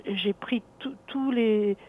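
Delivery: ordinary talk, nothing marked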